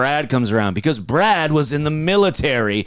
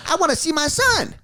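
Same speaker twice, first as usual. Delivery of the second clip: whiny divorced dad; 13 semitones higher than usual. Pretend. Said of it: "radio guy divorce".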